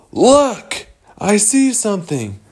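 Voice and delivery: high, sudden call to look; excited call. Acted. Wendy spotting something in the snow, excited. Wendy spots the hook, calls out.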